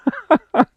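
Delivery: high rueful burst of laughter; embarrassed laugh. Real, not acted. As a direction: laugh at your own mistake. Embarrassed at forgotten gear.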